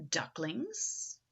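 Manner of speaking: plain reading voice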